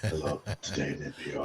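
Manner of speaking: very soft, low radio greeting; NPR host voice. Acted. Co-host mimicking an NPR show opening, hushed radio voice. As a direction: greet listeners in a hushed public-radio parody. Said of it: "everybody talks like this".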